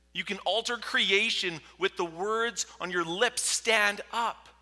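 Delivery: loud, high, urgent call to act; impassioned demand. Acted. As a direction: shout it up to God. Demanding God act, acted out.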